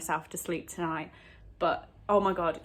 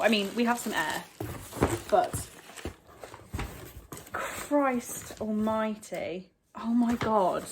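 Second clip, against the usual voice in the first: ordinary delivery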